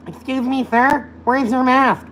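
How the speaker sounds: high, slow, prissy mock voice; mocking clerk. Mimicking a nagging store clerk, high and slow. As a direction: drag out the nagging question. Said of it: "Oh my goodness".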